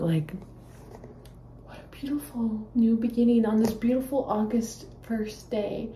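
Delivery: ordinary talk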